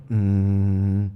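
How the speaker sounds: lowest possible closed-mouth hum; low techno bass hum. Shown as a demonstration. Teacher demos the techno bass to kids, low hum. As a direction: hit your bottom note, mouth shut. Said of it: "hitting the lowest note you can".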